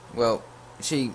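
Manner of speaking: normal talking voice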